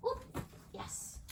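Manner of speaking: ordinary talk, nothing marked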